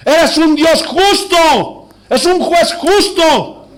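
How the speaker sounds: slow, high, ringing preacher's cry; forceful proclamation. Real, not acted. Preacher declaring divine justice to the congregation, slow forceful proclamation. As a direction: proclaim it in drawn-out, emphatic beats.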